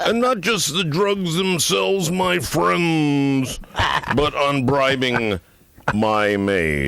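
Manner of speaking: high, slow, declaimed radio pitch; mock ad read. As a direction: stretch every phrase like a parody sponsor message. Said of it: "it's sort of like this".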